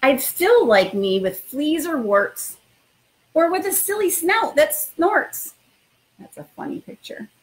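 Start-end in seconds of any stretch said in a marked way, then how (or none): 0.0-5.5 expressive storytime read-aloud; reading to children. Acted. Principal reading a picture book to new students, lively storytime voice. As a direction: read it like bedtime story time for little kids.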